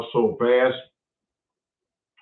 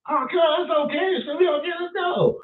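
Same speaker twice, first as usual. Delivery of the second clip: high, soft coaxing voice; coaxing horror movie teen; 4 dB quieter than usual. Acted. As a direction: coax him down the stairs in a high, soft voice.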